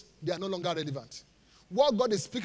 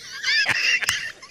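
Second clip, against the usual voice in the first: high, wheezing burst of laughter; laughing; 8 dB louder than usual. Real, not acted. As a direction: crack up at your own joke. Laughing at own iPhone joke.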